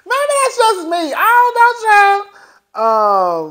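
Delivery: a playful squeal to the viewers; squeaky comic aside. Real, not acted. Comic aside after laughing fit.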